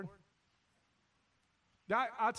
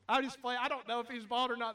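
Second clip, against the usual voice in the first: ordinary talk, nothing marked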